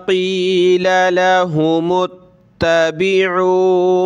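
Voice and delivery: slow, drawn-out tilawat; Quran recitation. Shown as a demonstration. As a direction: recite the verse slowly with melody. Recites the full verse. (धीमी सुरीली तिलावत)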